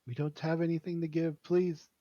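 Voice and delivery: high, loud, desperate plea; tearful begging. Acted. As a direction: beg for mercy as a weeping mother. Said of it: "sobbing in tears herself".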